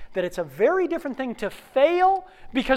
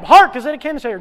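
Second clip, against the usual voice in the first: high, loud, rapid rehearsal; frantic shouted line; 6 semitones higher, 14 dB louder, much faster than usual. Acted. Late actor rehearsing on the dash, frantic shout. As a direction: shout it on the run.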